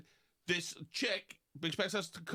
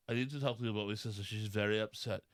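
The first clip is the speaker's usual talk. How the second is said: lower, fast, earnest put-on voice; imitating the sister; 8 semitones lower, faster than usual. The sister pleading her case to Tom, low and quick. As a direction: rattle it off like a grave messenger. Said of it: "her sister came over to my house and said".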